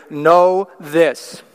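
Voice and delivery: "Know" hit hard with a falling tune; emphatic. Shown as a demonstration. Preacher quoting James's opening, emphatic. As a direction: stamp down on "Know". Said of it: "very emphatically".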